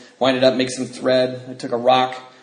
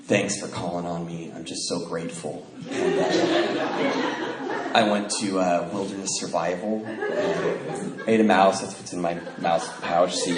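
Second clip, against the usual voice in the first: low, soft, humble-sounding hush; put-on spiritual; 6 semitones lower, 6 dB quieter, slower than usual. Young man sharing at a meeting about survival, mock spiritual. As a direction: emanate serenity, quietly and solemnly. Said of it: "supposed to talk like this is spiritual".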